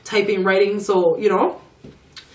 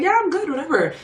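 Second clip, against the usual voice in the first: much higher imitation of the worker; breezy high reply; 10 semitones higher, faster than usual. Spa worker agreeing to take a client, breezy high reply. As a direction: toss it off in a high voice.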